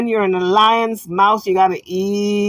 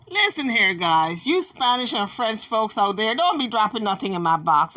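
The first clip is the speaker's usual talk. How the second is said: ordinary talk, nothing marked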